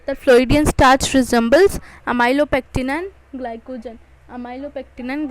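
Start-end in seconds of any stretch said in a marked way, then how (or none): 0.1-4.0 much louder, hammering the key fact; loud emphasis. Shown as a demonstration. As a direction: drive the point home loudly. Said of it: "This is very important for your neet".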